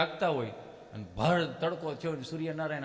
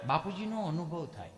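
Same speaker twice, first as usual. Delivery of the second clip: ordinary talk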